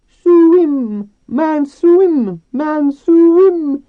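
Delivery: very high, loud squealed chant; urging dolphins. A narrator's reading. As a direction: squeal each word out like excited dolphins.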